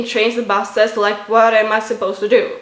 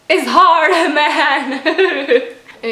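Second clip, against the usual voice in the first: emotional laugh; 6 semitones higher, much slower than usual. Real, not acted. Crying-laughing over her condition.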